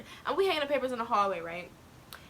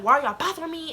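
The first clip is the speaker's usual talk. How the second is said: raised, higher-pitched protest; retold yelling; 6 semitones higher, 6 dB louder than usual. Student protests to bullies, retold yell. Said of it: "And I'm just yelling".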